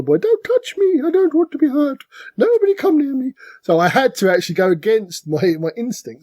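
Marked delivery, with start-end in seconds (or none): 0.2-3.3 high-pitched mock pleading to be left alone; timid turtler. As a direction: squeal it like a scared player hiding in a corner. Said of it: "normally I'm turtle in the corner".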